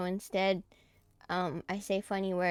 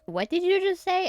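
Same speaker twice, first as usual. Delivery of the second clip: imitating classmates; 10 semitones higher, 8 dB louder than usual. Classmates' reaction retold.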